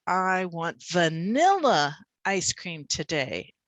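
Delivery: single out "vanilla" as the important word; stress on VANILLA. Shown as a demonstration. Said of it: "the stress changes the way".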